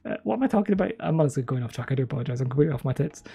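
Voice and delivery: ordinary talk, nothing marked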